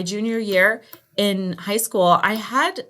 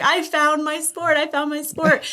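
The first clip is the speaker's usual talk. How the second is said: gleeful self quote; 8 semitones higher than usual. Retold or imitated.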